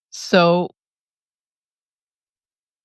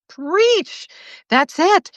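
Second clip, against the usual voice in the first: excited cheer; 11 semitones higher, much slower than usual. Real, not acted. Cheering a viewer's comment.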